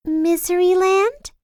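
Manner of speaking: put-on high, cheery girl voice; a curious, sunny lilt up high; bright Little Miss Sunshine. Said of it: "she thought to herself".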